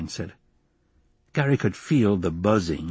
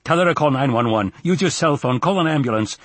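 mildly raised, impatient commands; exasperated orders; 6 semitones higher, 3 dB louder than usual. Acted. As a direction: reel off instructions with exasperation. Exasperated father giving orders.

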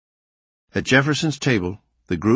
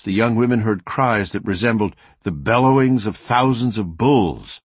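ordinary talk, nothing marked

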